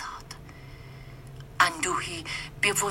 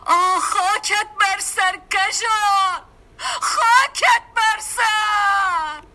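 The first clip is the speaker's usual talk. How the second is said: high, loud, slow anguished wail; keen over the dead in despair; wailing lament; 12 semitones higher, 7 dB louder, much slower than usual. A narrator's reading. Mother sees the young man killed, loud keening wail. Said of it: "نه جملات عاطفی".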